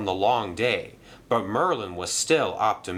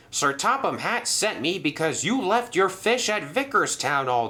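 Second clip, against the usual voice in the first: scolding manager; 9 semitones higher than usual. Acted. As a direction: tell him off about the fish. Mister Percival scolds.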